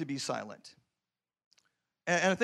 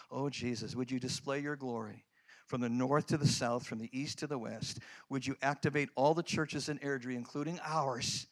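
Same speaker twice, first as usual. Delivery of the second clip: softer, earnest prayer voice, low in pitch; quiet prayer; 5 semitones lower, 5 dB quieter than usual. Retold or imitated. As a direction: pray it under your breath, pleading. Prays aloud on the walkway.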